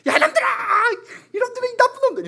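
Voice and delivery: half-laughing mock shout at naughty kids; mock yell. Anxious parent yelling at fighting children, mock high yell with laughter. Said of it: "이러고 있다라는 거예요".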